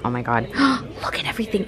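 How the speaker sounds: ordinary delivery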